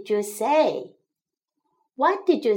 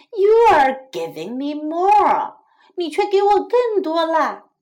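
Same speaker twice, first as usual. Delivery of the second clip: louder, higher mouse complaint; exasperated protest; 4 semitones higher, 6 dB louder than usual. A narrator's reading. Tired mouse complains to the crickets, exasperated. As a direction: protest with raised pitch and volume.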